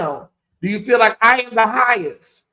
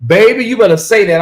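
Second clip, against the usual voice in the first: excited hype; 4 semitones higher, 8 dB louder than usual. Real, not acted. Cheering a viewer comment.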